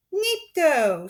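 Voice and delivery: put-on loud high baby shout; baby cry. Sunny calling out to stop Olaf. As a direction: call out like a toddler. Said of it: "unmistakable voice rang out".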